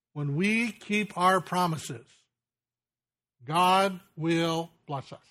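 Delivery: slow and deliberate, each word laid down; slow, weighty. Real, not acted. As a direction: drive the moral home slowly. Preacher drives home his point.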